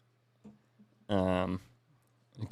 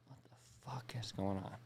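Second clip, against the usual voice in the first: hushed, unvoiced disbelief; stunned whisper; 15 dB quieter, slower than usual. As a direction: whisper it like you can't believe it. Inner reaction to absurd baby handoff.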